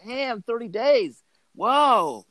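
high, drawn-out mock astonishment; retold wowed reaction; imitated amazement. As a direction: stretch it out high, like an amazed neighbor. Retelling the neighbor's amazed reaction.

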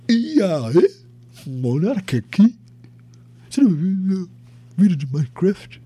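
lightly voiced streamer impression; a hint of the podcaster's voice, asking. Lightly voiced impression of a podcaster greeting his audience. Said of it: "não sei imitar o [name]".

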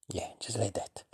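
soft whispered praise. Real, not acted. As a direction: murmur approval under your breath. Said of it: "Okay, try".

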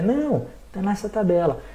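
ordinary delivery

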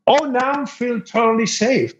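high-pitched sarcastic reassurance; mock relief. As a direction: act out feeling oh so safe. Sarcastic reply to tiny protector.

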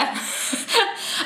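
high airy laugh, almost a sob; breathy laugh. Real, not acted. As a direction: let out a high breathless laugh, half crying. Laughs at own fear.

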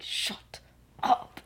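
whispered, halting retort; imitated whispered scolding; hushed shut-up. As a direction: shut them up under your breath.

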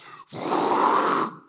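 loud, long whooshing noise; noisy wordless whoosh; imitated rushing dog sled. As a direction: make the noise of a sled roaring past.

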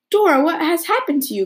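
high startled call; alarmed cry. Acted. Anne to soaked Dora at the tea table, high alarmed cry. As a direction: cry out at the dripping child. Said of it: "cried Anne".

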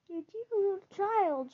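put-on a lady's delighted cry to the child; cried out. A narrator's reading. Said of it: "she cried".

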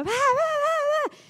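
put-on very high, drawn-out kid's yell; imitating a screaming child. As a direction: shriek it like a kid fighting in the background. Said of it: "se escuchaba de fondo".

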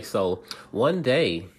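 plain reading voice

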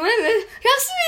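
high, choked stutter; barely gets the words out; stammering through tears. Retold or imitated. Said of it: "estava toda gaga". (a gaguejar e a chorar)

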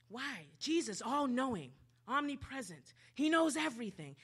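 ordinary delivery

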